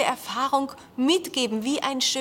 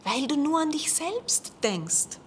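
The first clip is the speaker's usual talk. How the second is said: insistent; 7 semitones higher than usual. Acted. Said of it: "beharrte der Professor".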